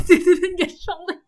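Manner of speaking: high, loud wail through laughter; half-laughing, squeaky outburst; laughing protest. Retold or imitated. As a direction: protest while cracking up. Mock protest while laughing.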